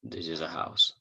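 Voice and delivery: ordinary talk, nothing marked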